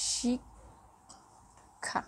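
শিক্ষা whispered slowly, barely voiced; teacher whispering শিক্ষা to show the sound; whispered demo. Shown as a demonstration. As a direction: breathe শিক্ষা out in a slow whisper. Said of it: "দেখো".

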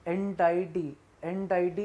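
"एंटाइटी" with an "eye" sound in the middle; alternative pronunciation. Shown as a demonstration. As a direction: say "एंटाइटी" as en-TIE-tee. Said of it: "बहुत से लोग इसको एंटाइटी भी बोलते".

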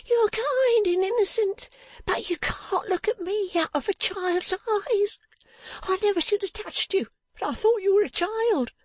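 very high woman's voice, urging; high-pitched character voice, agitated; distraught strange woman. Acted. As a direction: send the child away in a high, urgent voice.

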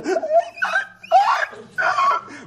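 put-on high squealing wail of a child who has cried himself out; dolphin meerkat wail. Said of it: "mixture of dolphin with a little bit of meerkat noise".

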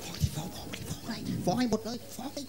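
sly soft nudging; hushed urging. Acted. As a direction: quietly goad the kid to tell dad. Mother urging kid secretly.

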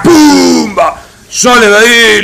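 loud, high, stretched exclamation; shouted live play call; explosive hit call. Real, not acted. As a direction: burst out on the impact. Commentator calls a sudden hit.

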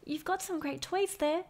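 a gentle hopeful ask; Trevor's voice. A narrator's reading.

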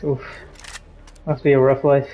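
ordinary delivery

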